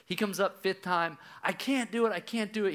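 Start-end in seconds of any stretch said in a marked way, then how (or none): 1.4-2.7 put-on high, urgent child's voice; repeated plea. Dad imitates son surfacing a fifth time, urgent plea. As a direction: imitate a struggling kid pleading over and over. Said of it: "He repeats it".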